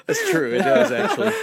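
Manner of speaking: high, loud laughing through words; can't stop laughing. Real, not acted. Cracking up over the joke.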